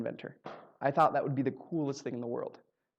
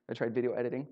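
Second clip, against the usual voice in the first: ordinary talk, nothing marked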